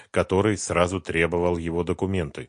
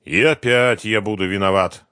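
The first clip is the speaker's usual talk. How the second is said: sighing; 4 semitones higher, 9 dB louder than usual. Acted. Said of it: "вздохнул Самвелов".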